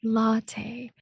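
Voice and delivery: "Latte" modelled as LA-tay; front stress. Shown as a demonstration. Answering how to order coffee, LA-tay "Latte". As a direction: stress the first syllable of "Latte" clearly. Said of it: "你要怎么说呢".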